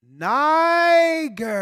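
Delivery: pitched high and loud, savoring it; preacher's loud triumphant reveal. Acted. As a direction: land the word like a punchline. The big reveal of the Bible word.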